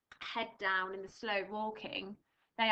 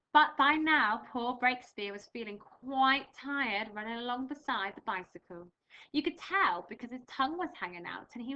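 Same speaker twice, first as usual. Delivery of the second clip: louder, animated storybook reading; reading to children; 5 dB louder than usual. Acted. Teacher reading Missus Armitage to pupils, animated read-aloud. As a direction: bring the story alive for young listeners.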